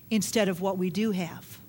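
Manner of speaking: usual speaking voice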